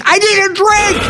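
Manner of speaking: high, hammed-up plea; mock desperate wail. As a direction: whine it like a drunk begging for a drink.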